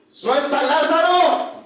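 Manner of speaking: ringing command to death; commanding Jesus. Acted. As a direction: order death to let go. Jesus commands death to release Lazarus.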